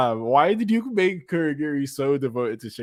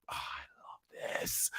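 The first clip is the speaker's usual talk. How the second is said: breathless whispered gush; hushed fan whisper; 16 dB quieter, slower than usual. Real, not acted. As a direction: whisper it, overcome with delight. Gushing over anime scene.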